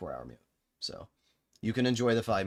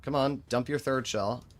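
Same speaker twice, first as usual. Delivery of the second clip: normal talking voice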